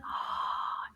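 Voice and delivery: soft whispered gasp of anticipation; a hushed gasp of surprise. Acted. Teacher gasping as the puppet arrives.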